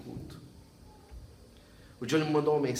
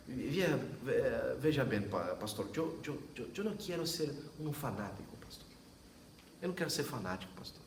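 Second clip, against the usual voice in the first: stammering, softer voice of the shy convert; hesitant Johnny; 7 dB quieter than usual. Retold or imitated. Pastor voicing a shy convert, stammering and hushed. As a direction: stumble over each word like an uneasy newcomer.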